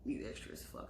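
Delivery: hushed unvoiced self-scolding; inner voice. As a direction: whisper it to yourself. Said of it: "I'm like".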